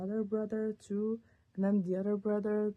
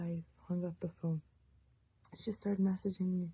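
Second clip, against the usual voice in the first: usual speaking voice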